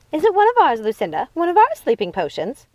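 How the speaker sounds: worried witch sister; sister asking. Acted.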